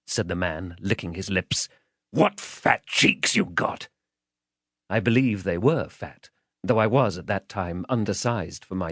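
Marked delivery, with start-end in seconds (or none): 2.1-3.9 slow, drawn-out gloating menace; menacing man. Acted. Man licking his lips at the child, menacing. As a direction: relish each word slowly, like a hungry brute.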